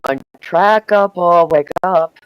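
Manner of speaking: loud drawn-out call. Real, not acted. Teasing call to wake friend.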